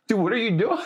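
high, alarmed mock protest; startled. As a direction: recoil from someone too close. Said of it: "Be like".

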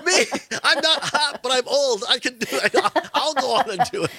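high, laughing plea to be picked; mock-eager volunteering. Host jokingly volunteers for The Golden Bachelor, mock-eager and laughing. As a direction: pipe up high, playfully begging to be chosen.

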